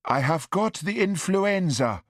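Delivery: put-on upset Highlander, sibilant accent. A narrator's reading. Upset son telling mother of influenza, sibilant Highland accent. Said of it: "his Highland accent growing more sibilant".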